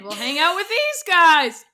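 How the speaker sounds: high, loud, slowed gush; eager switch. Retold or imitated. Telling friend they ditched the party, eager gush. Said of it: "we're like".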